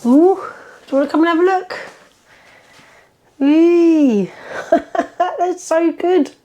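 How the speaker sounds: high, gushing voice for a pet. Real, not acted. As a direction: coo at them excitedly. Inviting someone to come look.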